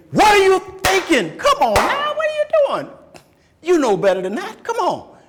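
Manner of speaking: loud, high exasperated scold; dad scolding. Retold or imitated. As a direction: scold the kid, fed up. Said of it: "One kid I can say".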